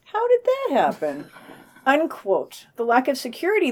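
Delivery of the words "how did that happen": much higher put-on puzzled voice; mock innocent bafflement. Joking about the vandalized Italian centre, high mock-puzzled voice. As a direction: feign total innocence about who did it.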